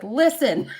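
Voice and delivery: loud mock-stern opener; mock scolding. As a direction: demand attention in pretend outrage. Retold mock scolding of Matt.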